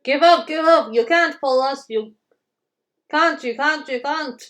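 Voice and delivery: high, loud jeering chant; loud sing-song taunt; taunting trucks. Acted. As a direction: taunt the engine from behind. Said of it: "called the trucks".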